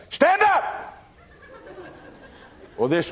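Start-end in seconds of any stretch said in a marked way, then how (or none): 0.1-0.6 sudden high-pitched shouted command. Retold or imitated. Pastor startling a sleeping churchgoer awake, sudden high shout. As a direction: jump way up high to jolt a sleeper.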